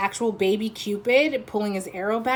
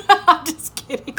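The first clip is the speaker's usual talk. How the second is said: higher laughing reassurance; 8 semitones higher, 8 dB louder than usual. Real, not acted. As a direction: laugh it off, high. Laughs after a joke insult.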